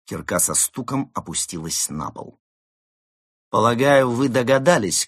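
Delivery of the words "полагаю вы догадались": loud, raised villain address; loud, self-important pronouncement; imposing. A narrator's reading. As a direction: project it like a gang leader. Gang leader addresses captive.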